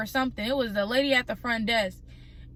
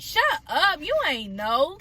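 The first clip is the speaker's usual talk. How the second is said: incredulous retort; 5 semitones higher than usual. Retold or imitated. Retorting to the lady.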